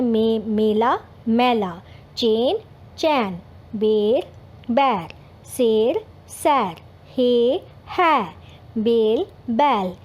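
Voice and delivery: each ए and ऐ word pair said slowly and clearly apart; vowel contrast drill. Shown as a demonstration. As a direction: run through the pairs stressing the vowel difference. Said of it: "sound का difference समझना है". (ए और ऐ की जोड़ियाँ धीरे दोहराइए)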